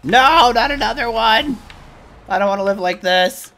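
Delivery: loud, high despairing cry; dismayed shout. Real, not acted. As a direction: wail in exasperation. Dismayed outburst at enemy.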